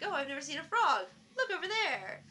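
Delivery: high, loud, sing-song amazement; excited skit character. Retold or imitated. Skit guy who has never seen a frog, excited and hammed-up. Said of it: "And then he's like".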